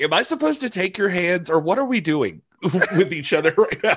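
high, bewildered asking; confused fan. Retold or imitated. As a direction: ask in baffled disbelief. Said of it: "I finally was just like".

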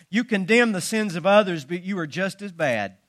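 ordinary delivery

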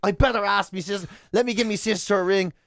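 usual speaking voice

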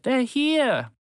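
high joyful cry; excited. Acted. Tamar telling the others her friends arrived, high and excited. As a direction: share the good news eagerly.

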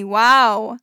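a loud, sudden burst of wow; surprised wow. Real, not acted. Host reacting to a listener's bird story, loud surprised wow. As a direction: blurt it out, caught off guard.

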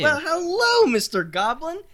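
high, loud sing-song hello; gushing greeting. Acted. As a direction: greet him like a beaming salesman. Centaur greets goblin theatrically.

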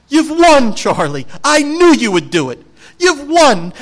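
high, loud burst of joy; hammed-up thrilled Wonka proclaiming victory; excited announcement. Wonka turning round to tell Charlie he won, excited and loud.

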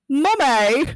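high, loud child's cry in mock British; hammed-up British child yelling for mother; British accent. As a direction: imitate a kid calling out in a British accent. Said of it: "is talking in a British accent".

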